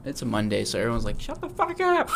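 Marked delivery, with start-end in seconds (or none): 1.2-2.1 annoyed neighbor. Retold or imitated. Neighbors complaining.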